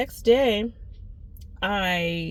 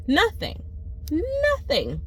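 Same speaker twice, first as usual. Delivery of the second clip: high exasperated "nothing"; disbelieving, rising outrage; indignant; 10 semitones higher, slower than usual. Real, not acted. Indignant about missing phone call.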